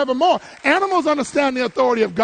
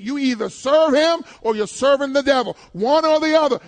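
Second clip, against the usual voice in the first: normal talking voice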